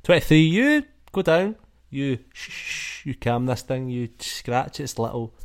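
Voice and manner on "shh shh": soft, drawn-out "shh"; soothing shushing. Acted. Game master acts out calming the pterodactyl, soft shushing. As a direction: hush a creature gently.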